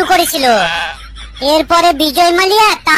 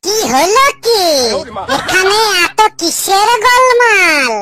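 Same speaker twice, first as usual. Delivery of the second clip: pompous secretary; 3 semitones higher, slower than usual. Acted. Secretary arrives, new character voice.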